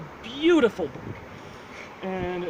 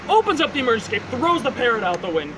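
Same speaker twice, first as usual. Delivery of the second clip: ordinary talk, nothing marked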